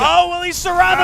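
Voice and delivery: high, tense gasp of a question; sudden thrill at the play; excited. Real, not acted. Tense moment in game.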